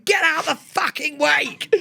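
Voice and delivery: retold angry yell. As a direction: bark each word at the driver.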